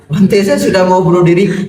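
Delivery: high-pitched mock-desperate cry; desperate outburst. Ruined man alarming the monk, mock-desperate outburst. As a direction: burst out in hammed-up despair.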